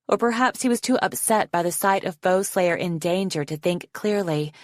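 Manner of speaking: ordinary delivery, nothing marked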